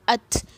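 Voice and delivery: अथ with air flowing out after the stopped t; hams on ta. Shown as a demonstration. Teacher has students feel the air at their lips, breath released after अथ. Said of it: "मुंह से हवा जो है वो बाहर".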